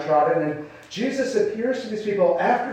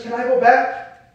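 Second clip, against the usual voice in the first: a higher, louder plea on "Can I go back?"; pleading question; 6 semitones higher, 4 dB louder than usual. Pretend. Worried believer asking God to undo the past, pleading. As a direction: beg to go back.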